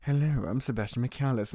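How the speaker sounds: lightly voiced suave butler; a hint of a smooth butler; Sebastian impression.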